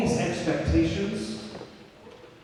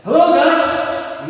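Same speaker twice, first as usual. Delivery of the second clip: high, loud, slow hail; demo greeting; 10 semitones higher, 6 dB louder, much slower than usual. Shown as a demonstration. President shows the students his greeting, high and loud. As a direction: stretch out the hello like a rally cry. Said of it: "I'm gonna start by saying".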